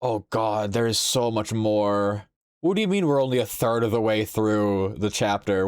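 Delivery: normal talking voice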